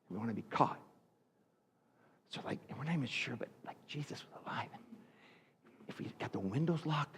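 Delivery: hushed, scared whisper behind locked doors; secretive, scared whisper of men in hiding. Acted. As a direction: whisper like a frightened disciple hiding.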